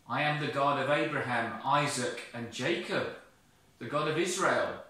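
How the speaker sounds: fast, loud, proud declaration; voice of God. Acted. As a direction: proclaim it boldly.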